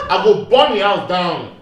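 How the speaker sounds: loud, slow, laughing declaration; laughing threat. Real, not acted. Joking about punishing his niece, laughing threat. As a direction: laugh while threatening to burn the house.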